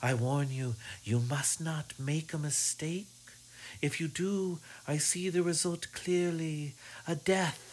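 high droning fortune teller warning. Acted. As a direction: intone the omen, high and ominous. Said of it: "her sing-song, droning voice".

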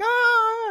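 high shrieking mock yell of panic; imitating crashing drivers screaming; mock panicked scream. Mimicking drivers on the icy hill, shrieking scream. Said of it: "they're all like".

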